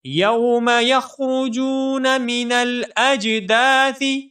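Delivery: slow, melodic tajweed chant; Quran recitation. Shown as a demonstration. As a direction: recite it drawn out like a qari. Recites verse. (تجوید کے ساتھ تلاوت)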